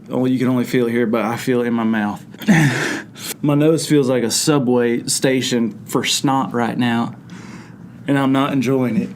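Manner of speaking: normal talking voice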